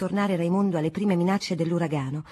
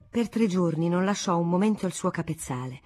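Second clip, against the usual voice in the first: ordinary delivery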